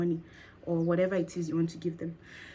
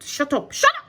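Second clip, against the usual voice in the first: sharp rising shut up, much higher; telling off own mind; 7 semitones higher, 6 dB louder than usual. Acted. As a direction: snap it at the voice in your head. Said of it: "That's me talking to my mind".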